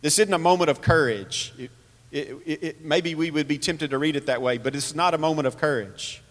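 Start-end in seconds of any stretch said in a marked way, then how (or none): none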